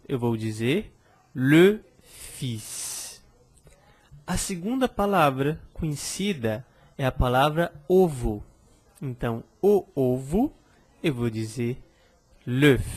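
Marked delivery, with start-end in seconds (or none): 1.3-3.2 "fils" with a long hissed final s; S final prononcé. Shown as a demonstration. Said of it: "a primeira delas é a palavra fils". 12.5-12.8 "l'œuf" with the final f sounded; F final prononcé. Shown as a demonstration. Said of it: "Eu pronuncio o F no final".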